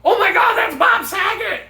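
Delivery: excited shout. Retold or imitated. Friends at the campfire screaming at the movie screen. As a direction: shriek it like a fan spotting a star. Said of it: "we're like".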